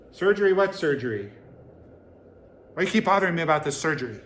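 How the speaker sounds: ostrich voice. Retold or imitated. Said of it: "doing my impression of an ostrich".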